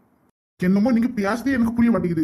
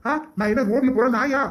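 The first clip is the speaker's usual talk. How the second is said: ordinary talk, nothing marked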